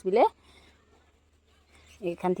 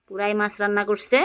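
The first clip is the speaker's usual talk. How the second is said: ordinary delivery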